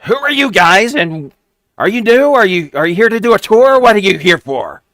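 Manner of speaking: very high, loud goblin squeak; a small goblin sizing up strangers, shrill-pitched; squeaky goblin. Acted. GM voicing a goblin questioning the rogue's party, squeaky and high.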